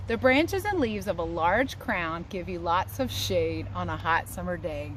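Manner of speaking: ordinary talk, nothing marked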